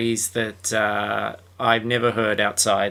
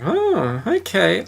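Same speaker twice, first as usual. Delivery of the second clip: a hint of the host; 8 semitones higher, slower than usual. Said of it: "And she's like".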